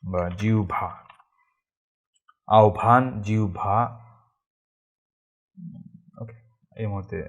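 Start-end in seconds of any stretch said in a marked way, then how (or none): 0.4-0.9 জিহ্বা said "jiu-bha"; Bengali pronunciation. Shown as a demonstration. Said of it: "এরকম উচ্চারণ হবে". 2.5-3.8 আহ্বান as "au-bhaan", জিহ্বা as "jiu-bha"; Bengali pronunciation. Shown as a demonstration. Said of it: "এরকম উচ্চারণ হবে".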